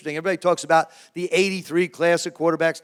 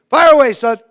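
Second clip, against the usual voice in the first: booming dad; 8 semitones higher, 12 dB louder than usual. Retold or imitated. Dad answering the phone.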